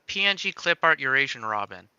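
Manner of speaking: ordinary talk, nothing marked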